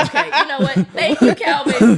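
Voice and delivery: loud, laughing thank-you. Real, not acted. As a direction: cut him off loudly, laughing.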